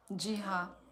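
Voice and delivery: ordinary talk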